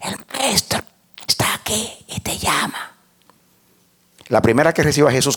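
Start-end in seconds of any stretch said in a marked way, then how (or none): 0.0-3.0 hushed, voiceless whisper; softly whispered message; secret whisper. Acted. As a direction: whisper it like a secret passed to a sister. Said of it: "diciéndole en secreto".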